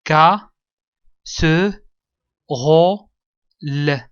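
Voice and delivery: "Ka, se, ro, le" martelé fort, en quatre morceaux; détache chaque syllabe de "Ka, se, ro, le", avec pauses; syllabe par syllabe. Shown as a demonstration. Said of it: "contient quatre syllabes". ("Ka... se... ro... le", le e final prononcé, très fort)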